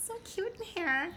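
put-on high cutesy baby voice; Bree's baby voice. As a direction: coo it like a little kid. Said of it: "baby voice Bree".

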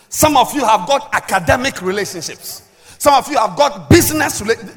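loud, emphatic preacher delivery; forceful preaching. Acted. Preacher teaching on Elisha's two fathers, forceful preaching. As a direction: drive each phrase home to the congregation.

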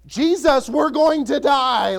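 loud, high, drawn-out cry of alarm; exuberant panicked cry. Acted. Disciples waking Jesus in the storm, panicked shout. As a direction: shout it as if waking someone in a sinking boat. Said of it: "probably a little more exuberant".

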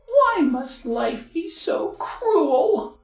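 put-on high, slow lamenting girl; sad little girl. A narrator's reading. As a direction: whine tragically as a girl. Girl laments her fate.

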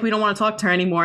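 fast aside. Pretend. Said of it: "they'll be like".

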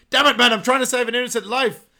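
high, loud TV-doctor shout; hammed-up doctor. Coop defending his shocking, hammed-up loud doctor shout. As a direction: bellow it like a melodrama surgeon. Mock hero doctor line.

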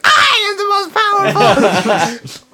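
high squeaky little voice, slowed right down; funny squeaky voice. Retold or imitated. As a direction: squeak it out like a tiny boastful PC. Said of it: "it has a funny squeaky voice".

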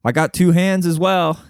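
eager buddy voice. Retold or imitated. Imitating a friend eagerly agreeing to help out. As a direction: lightly play the keen friend agreeing. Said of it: "He'd be like".

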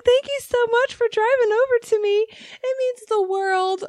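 very high, sugary thank-you; mock sweet customer. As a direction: gush falsely like a fake-nice customer. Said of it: "She's like".

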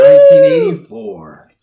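excited shout. Real, not acted. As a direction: belt it triumphantly. Announcing correct answer.

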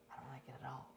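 hushed, embarrassed denial; protesting whisper. Retold or imitated. Speaker whispers her protest to Katie. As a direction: whisper it, squirming. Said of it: "look at you grown up".

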